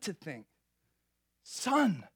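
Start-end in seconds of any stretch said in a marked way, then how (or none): 1.4-2.1 high, pleading mother voice; worried mother. Retold or imitated. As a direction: call out to her son like a frantic mom. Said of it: "his mother said".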